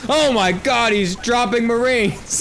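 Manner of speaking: high, excited mock shout; hype caster. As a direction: overhype a routine play. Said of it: "You're like".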